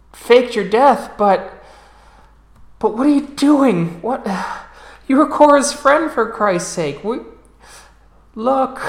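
high, loud, breaking pleas of a frightened father; a desperate dad shouting at his daughter in disbelief; father begging his daughter, high and loud; panicked father. Acted. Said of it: "as you see him going".

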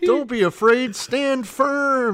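high, loud, hammed-up panicked reassurance; Moses. Moses rallying the Israelites, mock tearful panic. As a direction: shout comfort while barely holding it together. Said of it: "that's what I see here".

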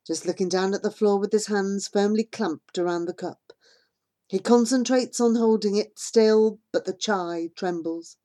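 usual speaking voice

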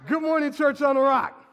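loud, rousing shout to the congregation; shouted greeting. Shown as a demonstration. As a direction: belt it out like you mean it. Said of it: "let me say it like you mean it".

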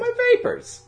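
very high fainting lady voice; mock swoon. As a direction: clutch pearls like a swooning lady.

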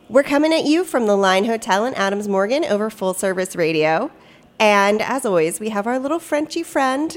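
ordinary delivery

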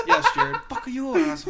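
cracking up while echoing the clip; laughing. Real, not acted.

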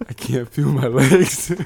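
loud, panicked can't feel my legs; mock panicked friend. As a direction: play the scared friend, hammed up.

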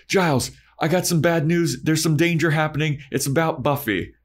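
lightly voiced alarmed Xander; urgent Xander.

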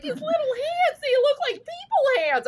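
squeaky-high gushing over something tiny; freaking out. Retold or imitated. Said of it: "I was freaking out".

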